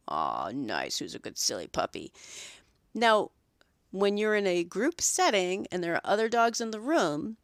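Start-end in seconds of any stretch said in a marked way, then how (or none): 0.1-2.1 very low, soft cooing praise; soothing praise. Retold or imitated. Groomer praising a now-calm puppy in a low soft coo. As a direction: coo low and gently to the pup. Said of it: "calm him down again".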